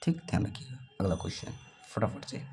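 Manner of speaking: unvoiced quiet aside; hushed whisper. Real, not acted. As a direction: whisper it to the class. Teacher moves on in a whisper.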